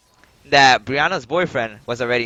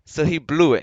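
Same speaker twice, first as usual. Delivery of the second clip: ordinary talk, nothing marked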